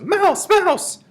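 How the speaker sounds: shrieky high cries of alarm; high, loud mock panic; alarm shouts. A narrator's reading. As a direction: cry out in a very high voice. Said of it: "There were no shouts of".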